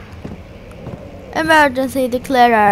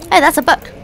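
ordinary talk

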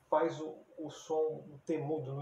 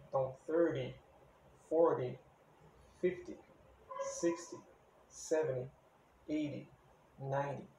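thirty, forty, fifty with the first syllable hit hard; stress on first syllable. Shown as a demonstration. Teacher counting tens for students, stress on first syllable. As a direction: lean on the front of each word, thirty, forty, ninety. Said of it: "a gente acentua a primeira sílaba".

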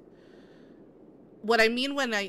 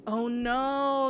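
ordinary delivery

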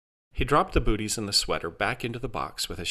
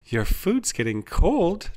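slow, high sing-out reminder; sugary, high, drawn-out reassurance; reassuring; 10 semitones higher, 4 dB louder, slower than usual. A narrator's reading. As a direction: coax sweetly, stretching the words. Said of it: "Trilda repeated reassuringly".

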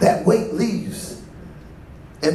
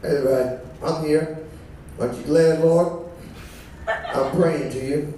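mock greedy prayer; 8 semitones lower, 5 dB quieter than usual. Mocking a self-centred prayer.

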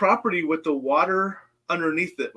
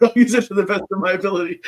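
said through a laugh, quicker and higher; 5 semitones higher, 5 dB louder, faster than usual. Real, not acted. Joking to the hosts about being a lazy person, laughing. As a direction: laugh your way through the line.

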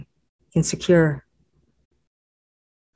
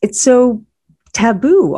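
incredulous; 6 semitones higher, 3 dB louder, slower than usual. A narrator's reading. Incredulous inner thought.

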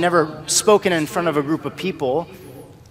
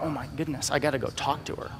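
soft, awed murmur; whisper-soft, overcome with love; hushed amazement; 10 dB quieter than usual. Retold or imitated. A driver moved by a homeless woman, murmuring in soft awe.

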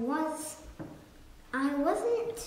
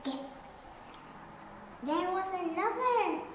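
ordinary delivery

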